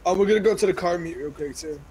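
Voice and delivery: usual speaking voice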